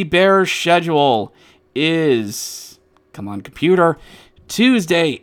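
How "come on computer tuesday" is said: ordinary delivery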